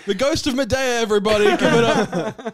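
hype announcer. Acted. Introducing a guest act to everybody, hyped-up announcer. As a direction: call it out like a show host. Hyping up a comic celebrity introduction.

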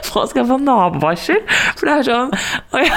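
high-pitched, through laughter; words squeezed out between giggles; laughing. Real, not acted. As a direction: protest while cracking up. Laughing about neighbours hearing her screams.

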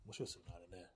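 usual speaking voice

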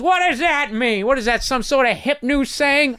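put-on old neighbor sneering at a saying; cranky old man. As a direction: squawk it like a baffled old neighbor. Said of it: "And he goes".

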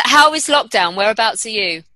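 usual speaking voice

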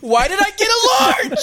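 mock lament. Character complaining about his large popcorn, shouting high. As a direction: yell out the regret. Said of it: "He's like".

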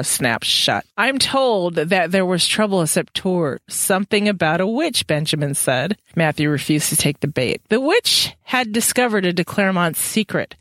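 even, measured reading voice; story narration. A narrator's reading. Podcast host reading the book to listeners, calm narration. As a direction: read it out like a novel. Reads novel aloud.

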